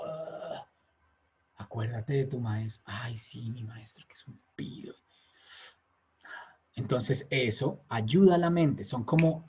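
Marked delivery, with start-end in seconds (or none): 0.1-4.9 hushed, softer self-reminder fading into a whisper; inner voice reminder. Retold or imitated. Teacher voicing a student's thought before meditating, hushed to a whisper. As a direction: coax yourself quietly to remember the master.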